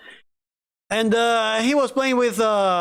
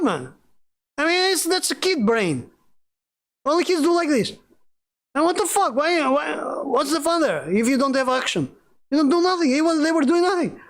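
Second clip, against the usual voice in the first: much higher, worked-up disbelief; exasperated rant; 8 semitones higher than usual. Real, not acted. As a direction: rant about the players, pitched high and heated. Ranting about childish players.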